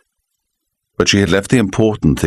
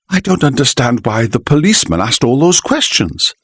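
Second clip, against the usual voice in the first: higher wife's voice, anxious; worried wife; 7 semitones higher than usual. A narrator's reading. Wife's voice in story.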